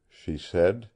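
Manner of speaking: plain reading voice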